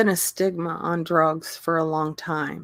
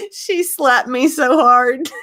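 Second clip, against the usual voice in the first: giggling through the words; laughing hard at the memory; 8 semitones higher than usual. Real, not acted. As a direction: laughs out the line. Laughing while telling.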